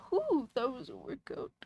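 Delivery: exhausted, whispered exhale; breathless whisper. Real, not acted. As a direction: puff it out, drained and winded. Winded after reading the story.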